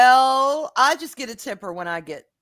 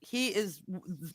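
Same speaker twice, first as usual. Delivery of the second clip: normal talking voice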